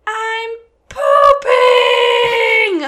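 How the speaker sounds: high, loud mock toddler yell; shouting across the room like a little kid. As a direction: belt it out like a proud two-year-old. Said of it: "as loud as he could yell".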